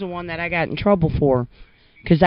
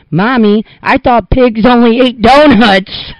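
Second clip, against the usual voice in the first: put-on high, loud and slow child's voice; loud little boy; 8 semitones higher, 9 dB louder, slower than usual. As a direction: announce it proudly like a four-year-old. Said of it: "and loudly says".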